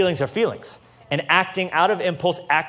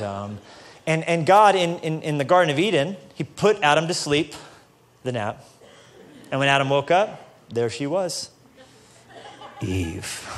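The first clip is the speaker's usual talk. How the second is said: ordinary talk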